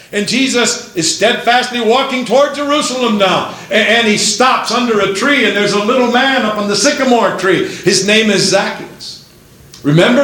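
high-pitched, rousing sermon storytelling; excited pulpit delivery with punched names; impassioned preaching. Real, not acted. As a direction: preach the story to a crowd, voice lifted.

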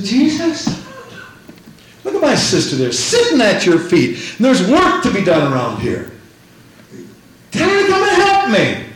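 high, whiny demand that he step in; exasperated Martha. Acted. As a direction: complain like an overworked sister tattling. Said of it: "she said".

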